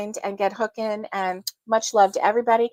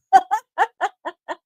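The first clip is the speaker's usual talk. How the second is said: high bubbling laugh; a delighted high laugh; real giggle; 8 semitones higher, 4 dB louder than usual. Real, not acted. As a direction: burst out giggling. Laughs at photo.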